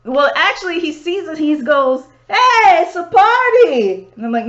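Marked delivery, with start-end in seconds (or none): 2.3-4.1 higher, louder, drawn-out party greeting; mock cheerful stranger. Stranger greets the group, playful mock cheer. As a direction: hail them like a drunk reveller. Retold Nepalese man greeting.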